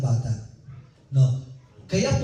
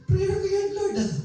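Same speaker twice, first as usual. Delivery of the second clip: much higher, insistent whining plea; envious pleading prayer. Retold or imitated. Preacher voicing a jealous believer insisting to the Lord, shrill mock plea. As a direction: push the pitch way up, protesting to God. Same prayer, pushed further.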